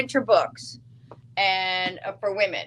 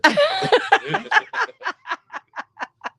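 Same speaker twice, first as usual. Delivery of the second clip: high, loud, rolling laugh; hearty laughter; 12 semitones higher, 5 dB louder than usual. Real, not acted. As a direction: burst out laughing and keep going. Laughs at his winter remark.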